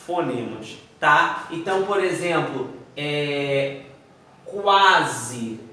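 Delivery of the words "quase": "quase" drawn out with a clear kw sound. Shown as a demonstration. Teacher giving an example, "quase" with its U sounded. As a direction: say "quase" slowly, the U heard after the Q. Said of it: "Eu tenho o som do U".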